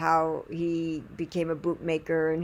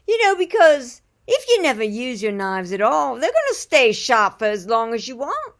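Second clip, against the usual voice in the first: louder, emphatic British imitation; British accent; 3 semitones higher, 6 dB louder than usual. Knife sharpener explaining sharpening to customers, British accent. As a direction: explain it in a put-on English accent. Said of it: "I think he's English".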